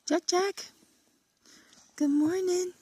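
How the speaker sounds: high pet voice. Real, not acted. Greeting a pet named Jack.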